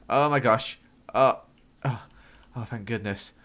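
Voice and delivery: ordinary delivery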